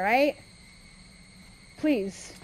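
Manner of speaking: normal talking voice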